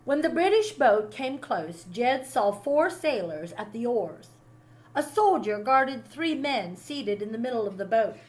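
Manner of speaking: plain reading voice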